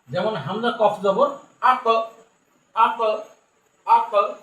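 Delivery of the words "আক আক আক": "আক" with the final consonant bounced into a short echo; "আক" released with a small bouncing puff of sound; qalqalah echo. Shown as a demonstration. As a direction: push off the end of "আক" so it rebounds. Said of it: "শব্দটা ধাক্কা দেয়".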